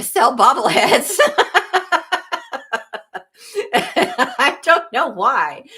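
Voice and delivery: real laughing. Real, not acted. Streamer baffled by Doug wanting bobbleheads, laughing. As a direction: break into high laughter mid-sentence. Cracks up over bobblehead merchandise.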